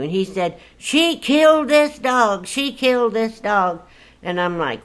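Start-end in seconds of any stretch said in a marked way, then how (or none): 0.8-3.8 high, frantic retold cry; husband screaming. Husband yelling to his wife that the dog died. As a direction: wail it like the husband did. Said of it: "my husband's screaming like a Apache".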